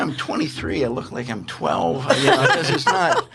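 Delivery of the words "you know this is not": high laugh. Retold or imitated. Retelling his own panic.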